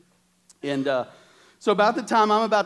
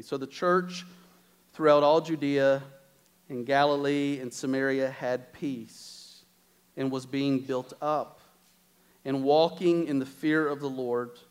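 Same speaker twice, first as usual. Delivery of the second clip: scripture reading; 4 semitones lower than usual. Acted. Reads scripture aloud slowly.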